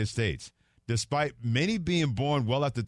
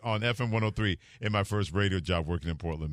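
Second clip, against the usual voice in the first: normal talking voice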